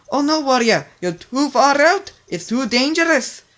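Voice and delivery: high, loud cry of alarm; alarmed warning. A narrator's reading. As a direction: warn him off in fear. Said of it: "O J tried to warn Warrior".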